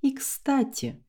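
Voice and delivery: ordinary delivery, nothing marked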